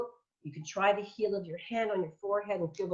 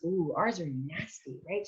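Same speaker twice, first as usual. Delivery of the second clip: ordinary talk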